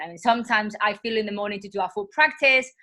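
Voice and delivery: ordinary talk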